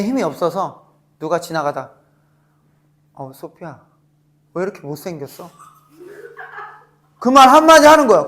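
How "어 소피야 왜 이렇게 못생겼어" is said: softer, tossed-off mocking jab; offhand insult. A passerby mocking Sofia, softer and offhand. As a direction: toss the insult softly in passing.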